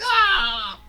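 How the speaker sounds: high, loud falling yell; falling scream. Retold or imitated. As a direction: yell while toppling over.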